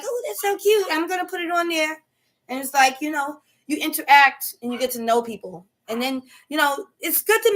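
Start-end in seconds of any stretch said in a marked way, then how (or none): 0.0-2.0 gushing knitter. Retold or imitated. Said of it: "And they're like".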